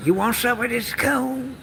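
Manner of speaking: lightly voiced country host, drawn out slow. Neighbor offering her coon meat, slow mock drawl.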